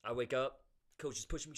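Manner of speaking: ordinary talk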